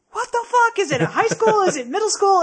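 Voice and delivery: loud, high, exasperated barrage of questions; imitated frantic parents. Host imitating confused San Antonio parents, mock frantic. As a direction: act out a crowd demanding answers, loudly. Acts out baffled parents' reaction.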